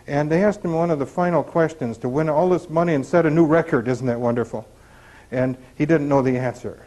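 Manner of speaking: usual speaking voice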